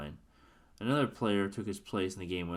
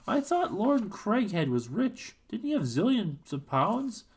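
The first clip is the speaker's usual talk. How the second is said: doubtful Frank; 14 semitones higher, 6 dB louder, slower than usual. Acted. Frank doubtful, questioning the colonel.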